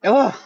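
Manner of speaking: loud, high groan of disgust; disgusted ugh. Real, not acted. Reacting to the claim about Summer, disgusted loud ugh. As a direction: let out a loud fed-up ugh.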